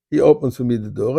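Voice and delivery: plain reading voice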